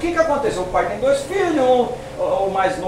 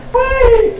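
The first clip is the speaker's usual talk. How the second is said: indignant son. Retold or imitated. Older brother protesting.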